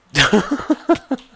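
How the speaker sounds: high burst of laughter; a loud high laugh after the blast; loud shocked laugh. Real, not acted. After creeper explosion.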